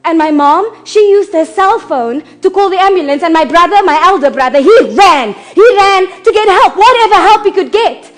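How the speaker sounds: frantic cry for help retold; urgent recounting. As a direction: raise the volume as the panic mounts. Urgent account of running for help.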